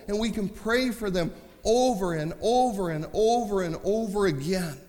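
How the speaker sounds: loud, high, shouting preacher; full-force preaching. Acted. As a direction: preach it out, building to a roar.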